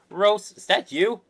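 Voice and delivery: high, loud, drawn-out question; startled recognition. Acted. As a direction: call out in acted disbelief. Acted surprise at someone appearing.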